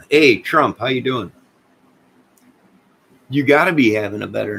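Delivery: loud, high friendly hail; calling out. Real, not acted. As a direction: call out across the room to Trump. Calling out to chat viewer.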